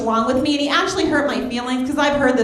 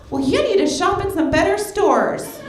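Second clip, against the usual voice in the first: snobby retort; 4 semitones higher than usual. Retold or imitated.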